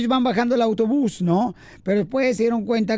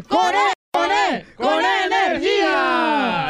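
shouted punchline; 5 semitones higher, much slower than usual. Acted. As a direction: belt it out like a cheer.

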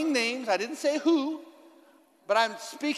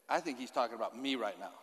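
much softer, low murmur to a neighbour; mock aside; 10 semitones lower, 9 dB quieter than usual. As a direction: confide it to the person beside you. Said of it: "Tap your neighbor. Say".

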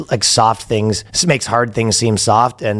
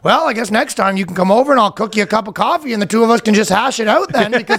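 higher-pitched mock Canadian; Canadian accent; 10 semitones higher than usual. Guy answers officers at his door, Canadian accent. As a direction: push back in a hammed-up Canadian accent. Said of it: "the most Canadian interaction".

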